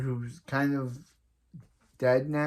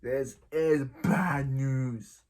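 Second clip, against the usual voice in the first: slow ominous repeat; much slower than usual. Pretend.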